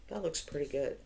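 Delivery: normal talking voice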